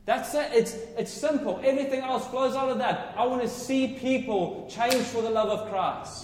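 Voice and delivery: loud, high, happy proclaiming; joyful shouting. Real, not acted. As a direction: shout it out for joy, not anger. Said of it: "I'm shouting 'cause I'm happy".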